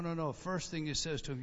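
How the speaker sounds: ordinary talk, nothing marked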